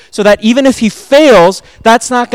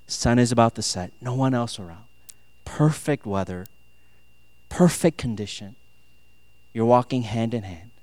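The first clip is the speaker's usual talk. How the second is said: low, soft storytelling voice; hushed, dreamy narration; romantic scene-setting; 8 semitones lower, 8 dB quieter than usual. Acted. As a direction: paint the scene quietly, in short unhurried phrases.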